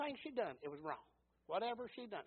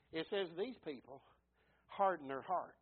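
usual speaking voice

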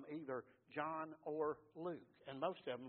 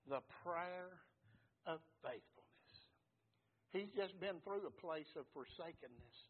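ordinary talk

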